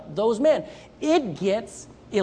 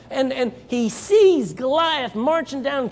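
ordinary delivery

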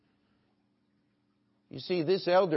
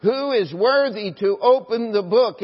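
loud, higher-pitched call to all; proclaimed challenge; 6 semitones higher, 8 dB louder than usual. Acted. Strong angel challenges all before the throne, loud proclamation. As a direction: throw it out as an open challenge. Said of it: "makes a challenge".

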